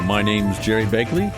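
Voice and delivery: ordinary talk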